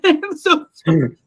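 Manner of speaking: cracking up while saying sorry; loud, high, giggled apology; laughing apology. Real, not acted. Apologises while cracking up over her slip.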